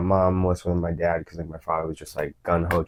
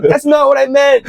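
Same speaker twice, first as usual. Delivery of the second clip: high, slow mock-tearful wail; teasingly imitating his mom crying after the fight; 11 dB louder than usual. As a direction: play his mom through tears, much higher and louder. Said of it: "And she's crying".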